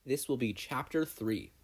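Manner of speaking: ordinary delivery, nothing marked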